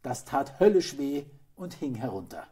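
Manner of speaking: usual speaking voice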